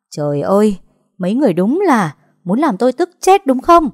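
high, loud furious cry; furious outburst. A narrator's reading. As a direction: explode at the whole family. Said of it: "Mụ Tí nổi điên".

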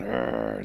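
low, loud rolled growl; wolf growl. Acted. Wolves answering turtle at the council, growling. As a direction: growl deep like an angry wolf. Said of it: "the wolves start growling".

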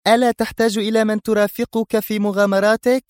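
put-on eager young woman. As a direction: ask with longing. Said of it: "سألته في شغف".

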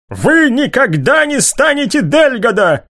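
high, slow furious outcry; furious shout. A narrator's reading. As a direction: shout it in a rage. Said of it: "выкрикнул Лукас в бешенстве".

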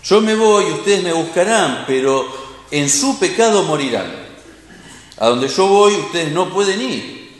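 scripture reading. Acted. Reading Jesus' words.

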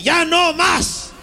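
very high, very slow squeal; high squeaky fed-up voice. Acted. Little butt character in the jungle, squeaky and fed up.

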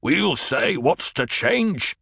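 a touch of defiance from the corner; angry voice. A narrator's reading. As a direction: crowd member answering Paul, mildly angry. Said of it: "An angry voice lifted from a corner".

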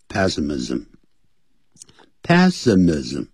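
"pessimism" said slowly and carefully as PESS-uh-miz-um; slow teaching repeat of "pessimism", stressed on the first syllable; pronunciation demo. Shown as a demonstration. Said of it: "啊，这么念".

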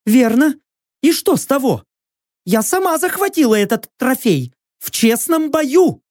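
high, firm warrior woman's voice; a proud captive bargaining; proud female warrior. Acted.

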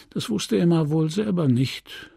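ordinary delivery

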